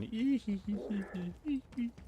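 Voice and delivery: giggle. Real, not acted. Streamer laughs at little character.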